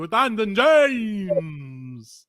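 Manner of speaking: spooky, slow vampire host voice; drawn-out creepy vampire welcome. Acted. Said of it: "You have to work on your vampire voice".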